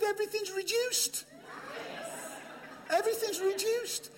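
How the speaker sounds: high, breathless thrill over a bargain; excited secret. Retold or imitated. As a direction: share it like the best news ever. Gail excitedly shares bargain.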